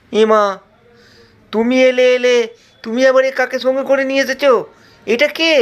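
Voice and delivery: shocked at an extra guest. Acted. Disciple shocked by Sita.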